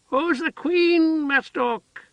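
very high, slow excited voice of a simple woman; eager childlike cry. A narrator's reading. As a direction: call out like a delighted child. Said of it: "Heather cried".

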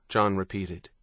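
ordinary delivery

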